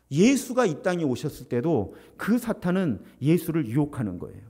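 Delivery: normal talking voice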